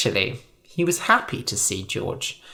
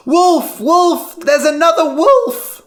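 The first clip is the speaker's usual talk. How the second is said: high, loud alarm shout; 8 dB louder than usual. A narrator's reading. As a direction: holler it across the field. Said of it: "Lyman shouted".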